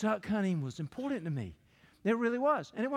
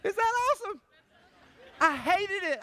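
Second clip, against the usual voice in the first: high, loud and exclaiming; excited outburst; 10 semitones higher, 4 dB louder than usual. Real, not acted. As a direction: burst out with high energy. Excited reaction after poem.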